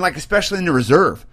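ordinary talk, nothing marked

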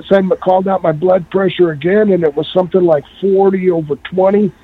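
ordinary delivery

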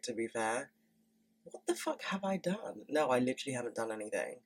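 usual speaking voice

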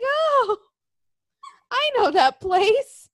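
high, drawn-out realization; amazed recognition. Retold or imitated. Recognizing the Target they used to work by, amazed recognition.

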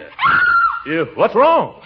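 sudden high alarmed cry; startled shout. Acted. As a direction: jump at the scream and call out. Uncle startled by her scream.

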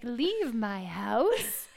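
drawn-out mock demand; mock dismissal. Homebody playfully sending guests away once it's dark, drawn-out dismissal. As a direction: stretch every word out like a comic order.

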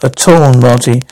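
ordinary talk, nothing marked

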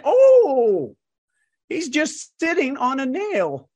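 drawn out in a high mock old-timer voice; old man voice. Old man's punchline in story.

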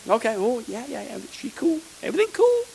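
high, soft laid-back voice; breezy, no-problem tone; mock easygoing reply. Preacher imagining himself being relaxed if she were the wife, high and soft.

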